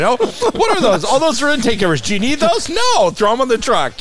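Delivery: playfully hammed-up voice, grabbing everything in sight; mock eager stealing. Acts out stealing again.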